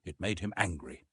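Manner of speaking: ordinary delivery, nothing marked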